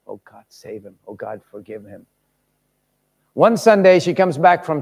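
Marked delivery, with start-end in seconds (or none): 0.1-2.1 desperate prayer. Acted. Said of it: "cry desperately before God".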